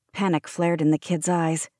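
plain reading voice

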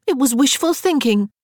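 high-pitched self-defence; protesting. A narrator's reading. Elizabeth excuses her swearing to her sisters, protesting. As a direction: insist you meant no wrong. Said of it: "Elizabeth protested".

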